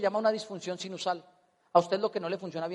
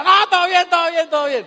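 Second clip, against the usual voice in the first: high, loud laughing all good; laughing reassurance; 8 semitones higher, 10 dB louder than usual. Retold or imitated. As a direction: brush it off, laughing. Reassuring paramedic after fake scare.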